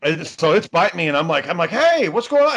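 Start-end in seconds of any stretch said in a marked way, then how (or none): 1.7-2.5 alarmed call. Retold or imitated. Dog still biting him.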